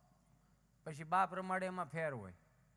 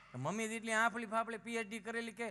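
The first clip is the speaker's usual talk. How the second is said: ordinary talk, nothing marked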